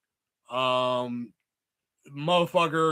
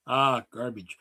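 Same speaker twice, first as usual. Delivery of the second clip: ordinary talk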